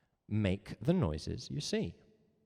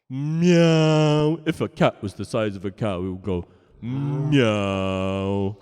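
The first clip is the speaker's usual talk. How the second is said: slow, louder, drawn-out huge meow; giant cat meow; 10 dB louder, much slower than usual. Shown as a demonstration. Teacher voicing a cow-sized cat for chat.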